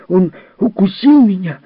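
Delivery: high, loud angry yell; shouted complaint. A narrator's reading. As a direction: yell it as a hurt boy. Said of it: "крикнул Джерри".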